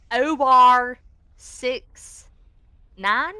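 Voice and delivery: loud call. Retold or imitated. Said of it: "she'll go".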